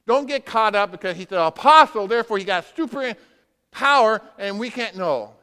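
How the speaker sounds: loud preacher's push on super power; emphatic preaching. Real, not acted. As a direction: warn them forcefully.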